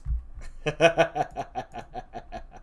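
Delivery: long, steady chuckle, fading; staged laugh. Acted. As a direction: laugh in an even rattle at the joke. Laughs after the acted verse.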